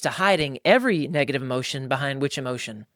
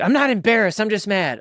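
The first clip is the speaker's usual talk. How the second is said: high, louder play-acted snap; mock angry denial; 8 semitones higher, 6 dB louder than usual. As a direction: toss it back as the irritated wife.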